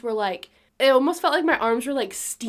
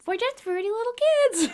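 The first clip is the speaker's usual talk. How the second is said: high-pitched mock jingle-style kid voice; hammed-up little-kid commercial voice; kids' commercial; 13 semitones higher, 3 dB louder than usual. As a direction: playfully pitch it up like kids in an ad. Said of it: "on their own little commercial".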